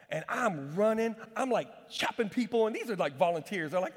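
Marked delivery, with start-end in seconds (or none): none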